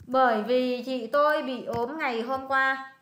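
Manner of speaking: "Bởi vì chị tôi bị ốm ngày hôm qua" read slowly and clearly; slow model sentence. Shown as a demonstration. Tutor slowly models the Vietnamese translation for her student. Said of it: "con dịch cho coi rất là thuận lợi này".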